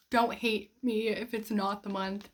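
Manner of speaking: usual speaking voice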